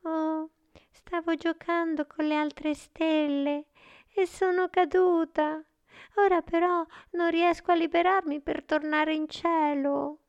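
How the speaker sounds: very high, plaintive star voice; murmuring little star. A narrator's reading. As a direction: play a tiny stranded star, pitched right up. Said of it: "Lei sussurrò".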